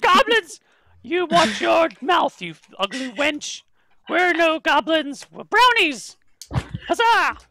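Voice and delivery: high, loud indignant little fey; angry brownie shout. Acted. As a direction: shriek it like an insulted tiny creature. GM voices offended brownie.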